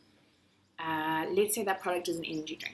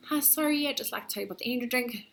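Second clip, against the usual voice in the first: rushed sales pitch; 5 semitones higher, faster than usual. Retold or imitated. Rushed pitch to the next person.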